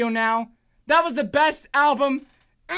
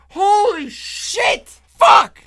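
high, loud, drawn out, bursting at the end; amazed shout; 6 semitones higher, 8 dB louder, much slower than usual. Real, not acted. Fan floored by the album, amazed shouting. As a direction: stretch it out, then yell the last word.

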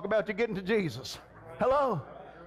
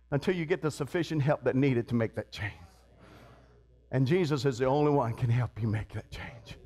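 solemn low preaching; 10 semitones lower than usual. Real, not acted. Preacher pointing church to Jesus as helper, low and solemn. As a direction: pitch dropped far down.

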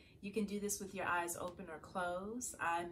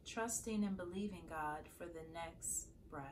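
calm, softer meditation voice with long pauses; guided meditation; 7 dB quieter than usual. Acted. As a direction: guide listeners gently into prayerful stillness, leaving space to breathe. Leads a morning meditation.

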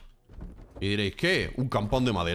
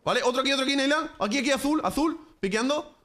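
excited callouts; 9 semitones higher than usual. Real, not acted. Calling enemy positions.

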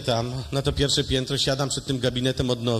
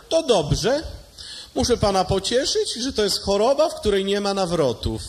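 high, raised doctor's consolation; mock consoling doctor; 5 semitones higher than usual. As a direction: console him with fake cheer. (lekarz pociesza z udawaną troską, wyżej)

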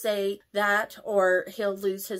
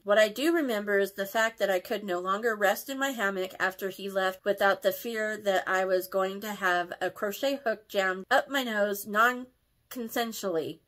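ordinary delivery

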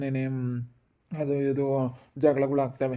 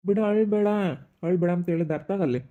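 high, loud scolding; exasperated shout; 4 semitones higher, 4 dB louder than usual. Acted. Husband yelling at his weeping wife to stop crying. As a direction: snap at her to stop crying.

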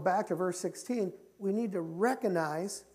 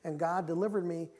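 ordinary delivery

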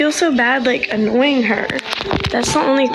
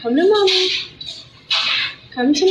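much higher cooing pet voice; 7 semitones higher than usual. Real, not acted. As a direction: coo to coax the dog over. Calling dog to her.

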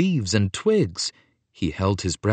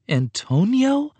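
high, slow stunned question; shocked gasp; 12 semitones higher, slower than usual. Acted. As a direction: recognize him in disbelief. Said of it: "Paolo gasped".

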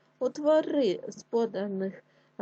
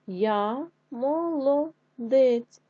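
slow emphatic repeat; 4 semitones higher, 4 dB louder, much slower than usual. Shown as a demonstration. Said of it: "Що?".

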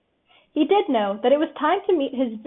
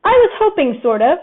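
higher, louder hopeful exclamation; exclaiming; 4 semitones higher, 8 dB louder than usual. A narrator's reading. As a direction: burst out with hope to the friends. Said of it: "exclaimed Pooh later to his friends".

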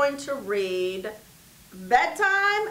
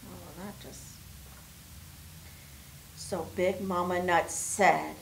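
ordinary delivery

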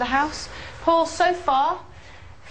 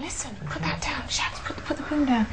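urgent hushed whisper; 2 semitones higher, 4 dB quieter than usual. Real, not acted. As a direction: whisper orders to the crew without breaking the quiet. Hushed instructions to crew.

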